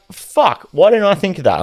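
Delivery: high-pitched mock exclamation; acted surprise, voice jumping up; retold realisation. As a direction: exclaim it as it dawns on you. Retells own sudden realisation.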